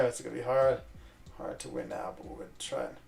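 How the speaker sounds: ordinary talk, nothing marked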